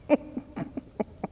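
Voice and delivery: breathy stifled giggle; reliving the uncontrollable giggles; helpless giggle. Retold or imitated. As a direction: laugh you can't hold back in a quiet room. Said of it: "And so I start laughing".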